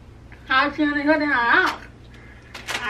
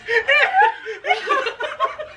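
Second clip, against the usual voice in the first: high squealing laugh; 12 semitones higher than usual. Real, not acted.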